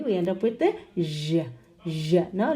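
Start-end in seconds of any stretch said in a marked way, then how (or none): 1.0-2.3 "zh" with a short schwa, as "zhuh"; isolated buzzing "zhuh" demo; SURE ending. Shown as a demonstration. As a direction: give "zh" as the weak syllable "zhuh". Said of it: "when we have the S U R E".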